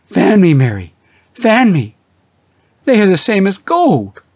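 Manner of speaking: husband nearly fainting over the checks. A narrator's reading. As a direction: gasp it out, dizzy with surprise. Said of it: "came near to fainting".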